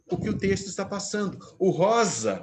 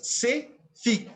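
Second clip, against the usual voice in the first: "se" and "fique" as two slow, higher beats; syllable demonstration; 6 semitones higher, slower than usual. Shown as a demonstration. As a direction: beat out "se … fique". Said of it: "de duas sílabas". ("se fique" em duas batidas lentas)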